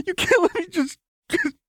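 very high tearful plea, petering out; trailing off. A narrator's reading. Lux faltering as she pleads with Jinx, trailing off. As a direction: lose the words mid-sob. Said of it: "She trailed off".

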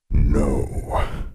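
low, drawn-out monster refusal; menacing sphinx, dropped pitch; deep growl. A narrator's reading. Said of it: "he growled, in a voice that came from somewhere very deep".

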